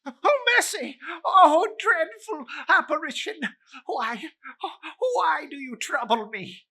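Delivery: high, loud terrified cry; terrified plea. Acted. Scrooge begs the ghost for mercy, high and crying. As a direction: beg for mercy in terror.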